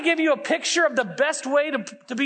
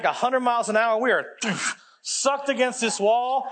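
ordinary delivery

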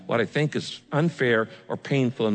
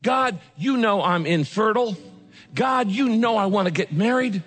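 higher, rising plea; pleading prayer; 7 semitones higher than usual. Shown as a demonstration. Preacher modelling a complaint to God, rising pleading. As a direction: beg God, climbing. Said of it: "When you say".

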